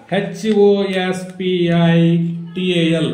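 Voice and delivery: H ఓ ఎస్ పి ఐ run together slowly, then టి ఏ ఎల్; slow chant-like run H ఓ ఎస్ పి ఐ, break, టి ఏ ఎల్; grouped spelling. Shown as a demonstration. Teacher shows spelling up to the marked lines, letters joined. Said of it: "గీతలు కొట్టిన కాడికి".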